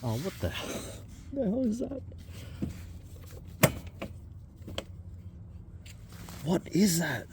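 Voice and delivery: high, startled disbelief; shocked at what she sees; surprised. Real, not acted. Strange find dug up.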